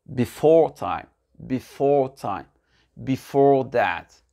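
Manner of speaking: slow and deliberate, "Before" stressed on its long second syllable; slow repeat-after-me model. Shown as a demonstration. Teacher modelling phrases to repeat, slow and deliberate. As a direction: draw out each word for learners to copy. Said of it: "Répétez après moi".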